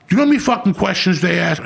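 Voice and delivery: high, fast exasperated rant at the hospital. Real, not acted. Ranting about hospital questions.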